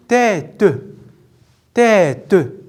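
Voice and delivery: "Tête" split as tê-te, final te sounded; syllable by syllable. Shown as a demonstration. Teacher counting syllables with pupils, "Tête" said tê, te. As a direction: give "Tête" two separate beats each time. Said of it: "Et la deuxième".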